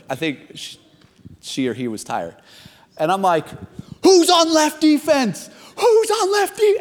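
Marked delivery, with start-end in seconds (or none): 4.0-6.8 high, intense yell across the field; freaking out. Retold or imitated. Intense player yelling at teammates about defense, freaking out. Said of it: "And I'm freaking out".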